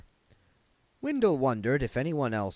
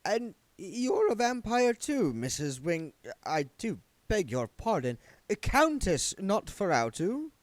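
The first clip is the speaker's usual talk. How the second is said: higher, timid zombie clerk; a nervous, polite little man, pitched up; Wendell's voice; 11 semitones higher, 3 dB louder than usual. A narrator's reading.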